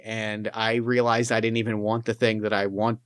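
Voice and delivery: normal talking voice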